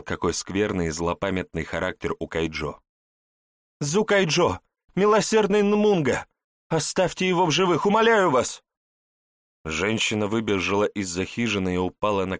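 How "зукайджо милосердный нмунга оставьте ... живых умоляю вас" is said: high, desperate begging woman; pleading woman. Acted. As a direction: beg for mercy on your knees. Mother begs for son's life.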